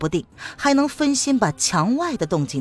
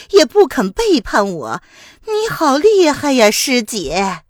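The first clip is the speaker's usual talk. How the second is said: higher, louder, sneering taunts with scoffs; mocking woman; 4 semitones higher, 4 dB louder than usual. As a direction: needle her rival with spite. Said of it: "玉兰子居然也在笑". (尖刻嘲讽的女声)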